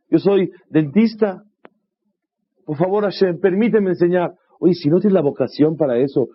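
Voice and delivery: mock objection. Imagined congregant objecting.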